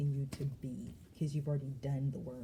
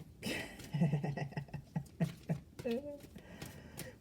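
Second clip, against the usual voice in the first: airy rising laughter; breathy laugh; 4 dB quieter than usual. Real, not acted. Amused by the archangel's laugh message, breathy laughter.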